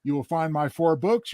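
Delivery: ordinary delivery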